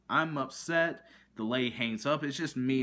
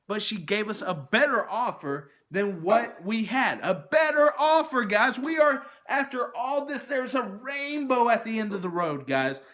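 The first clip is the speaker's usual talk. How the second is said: louder and much higher, bursting with triumph; building jubilation; 8 semitones higher, 6 dB louder than usual. Real, not acted. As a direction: build from emphatic to loud, high-pitched celebration. Celebrating the better offer found.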